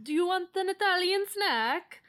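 put-on half-hearted Italian accent; attempted Italian accent. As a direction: imitate an Italian man making his pitch. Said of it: "I can't really do an Italian accent".

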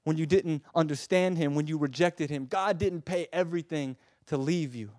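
loud, high impassioned preaching; full-force preaching. Acted. Preacher to congregation, loud impassioned preaching. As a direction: plead with fire.

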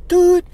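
a high, loud buzzer "Doot"; intercom buzz. Retold or imitated. Recalling the meeting office, imitating the intercom buzz loud and high. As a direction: mimic the office intercom going off. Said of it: "that buzz on the intercom".

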